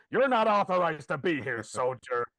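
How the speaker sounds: loud, commanding soldier toy; Tommy Lee Jones. Acted. Motion-sensor toy challenging whoever walks in, loud impression. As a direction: order the intruder out in character. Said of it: "with the voice of Tommy Lee Jones".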